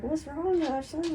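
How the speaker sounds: retold questioning, lifted in pitch; imitated boss.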